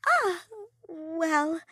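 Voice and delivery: high, hesitant "Ah, well"; flustered hesitation. A narrator's reading. Soleil stalling when asked about her coat, high and flustered. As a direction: caught off guard, stalling.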